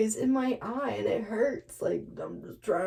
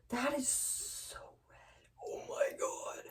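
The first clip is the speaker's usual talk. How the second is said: breathless whisper of awe; hushed, stunned whisper at the bright red dye; awed whisper; 3 semitones higher, 12 dB quieter than usual. Real, not acted. Shocked at the dye colour.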